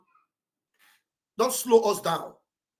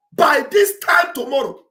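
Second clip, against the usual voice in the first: high, loud preacher cry on "this"; preaching prophecy; 6 semitones higher, 7 dB louder than usual. Retold or imitated. Speaker quoting Elijah's prophecy to supporters, loud preaching. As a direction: proclaim it like a church sermon. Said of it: "I feel like, you know, I'm in church now".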